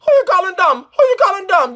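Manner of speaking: high, loud mock outrage; hammed-up offended shout. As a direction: fire back high and indignant.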